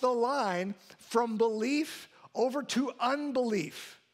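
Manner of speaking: preacher building fervour; rising preaching. Acted. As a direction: drive the point home to the congregation.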